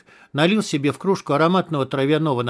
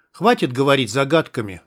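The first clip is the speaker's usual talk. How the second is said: plain reading voice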